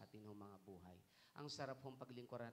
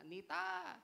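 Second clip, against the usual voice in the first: high shrill-free mock shout, much higher and louder; neighbor's yell; 8 dB louder than usual. Angry neighbor calls for Michael's mother, mock yell. Neighbor yelling about shot chicken.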